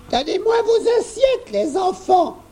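put-on high, sweet old lady voice; grandma asking nicely for the plates; kindly grandmother. A narrator's reading. Grand-mère asks the children kindly, high sweet voice. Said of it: "demanda grand-mère d'un ton aimable".

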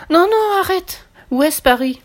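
very high, loud panicked no; panicked shark. A narrator's reading. Seasick shark begging the doll to stop, high panic. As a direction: squeal in alarm.

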